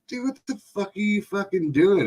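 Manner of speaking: high, stunned disbelief; high, shocked retold question. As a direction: ask it high and appalled. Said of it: "I was like".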